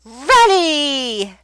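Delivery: loud, high, slow upbeat classroom voice; cheerful kid teacher. Shown as a demonstration. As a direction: greet a young student brightly. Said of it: "Those are good".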